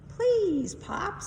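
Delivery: put-on high, loud pleading girl; pleading daughter. Florence begging her father to take the glove off, high and pleading. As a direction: beg her dad like a young girl. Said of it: "said Florence".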